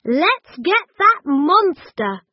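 high and loud, punching that; rally the hunt gleefully; eager. A narrator's reading. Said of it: "said Gran".

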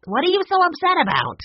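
angry retort. A narrator's reading. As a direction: bark it back impatiently. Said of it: "Ned growled".

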